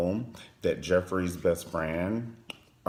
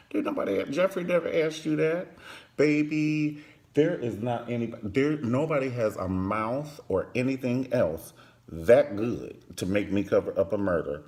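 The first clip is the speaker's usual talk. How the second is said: ordinary talk, nothing marked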